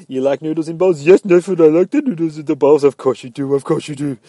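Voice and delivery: high, loud doting pet talk; talking to a dog. Acted. Owner talking to his dog Stixie, gushing pet talk. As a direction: gush at the dog in excited pet-owner chatter. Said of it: "same way I talk to Stixie".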